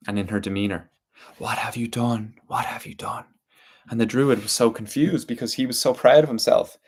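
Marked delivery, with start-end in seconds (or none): 1.4-2.3 mild reproach, otherworldly; reproachful spirit. A narrator's reading. Water spirit scolding the proud druid, mild reproach. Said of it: "he could hear the reproach in this water spirit's voice". 2.5-3.2 breathy whisper of a spirit; whispered reproach. A narrator's reading. Water spirit echoing her question to the druid, whispered.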